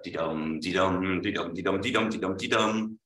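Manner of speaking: sing-song da-DUM beat, weak then strong; iambic dee-dum. Shown as a demonstration. Lecturer demonstrating iambs to students, da-DUM rhythm. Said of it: "like the theme to the Pink Panther".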